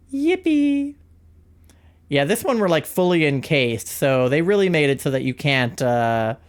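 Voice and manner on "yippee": squeaky marble voice. Pretend. Playing the marble, squeaky cheer.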